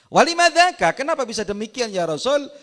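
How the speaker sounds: high, loud pleading question; companion's eager question. Acted. Companion asking the Prophet why, in a high loud plea.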